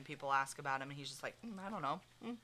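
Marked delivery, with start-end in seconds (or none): 1.5-2.4 mock-casual shrug in the voice, softly; soft, noncommittal imitation; unfazed. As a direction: play it lightly unfazed, brushing the question off. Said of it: "Eli doesn't seem fazed".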